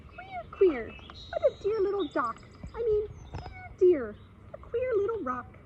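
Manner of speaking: very high, squeaky and tiny; a small mouse chattering to itself; funny little mouse voice. Acted. Mouse squeaking to itself about a rock, very high. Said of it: "heard a funny little voice say".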